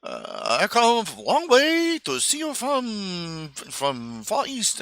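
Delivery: high, slow put-on Chinese accent; exaggerated Chinese accent parody, drawn out; mock Chinese accent. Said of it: "they say".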